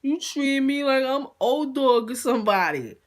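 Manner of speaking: high-pitched mock pout; puppy-dog sad. Imitating the guest who feels treated like a dog, puppy-dog sad. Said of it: "a straight puppy dog, sad face".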